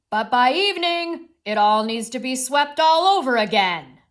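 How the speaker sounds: loud, frustrated outburst; high, loud exasperation, sustained to the end; exasperated mole. Acted. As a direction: burst out as a fed-up storybook mole. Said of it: "like he's exasperated".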